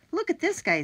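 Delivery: high, loud and eager; excited. Acted. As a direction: point it out with a jolt of excitement. Jack points at picture.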